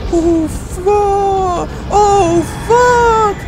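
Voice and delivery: high and loud, desperate; real fear spilling out; panicked cursing. Real, not acted. Player cursing and begging as the doors open.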